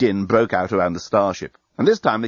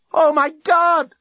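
high, loud, drawn-out scream; 5 dB louder, slower than usual. A narrator's reading. Woman screaming as guns rip into the starship. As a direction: cry out slowly in horror. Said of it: "screamed Lucy".

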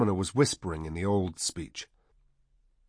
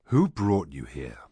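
low, deep voice of a summoner; deep-voiced summoner; 4 semitones lower than usual. A narrator's reading. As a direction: ask it in a deep, lowered voice. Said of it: "in his deep voice".

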